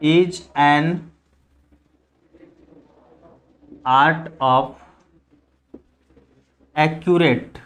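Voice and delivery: low, very slow, long pauses between words; writing-speed delivery, word by isolated word; slow dictation. Real, not acted. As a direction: dictate it piece by piece while writing.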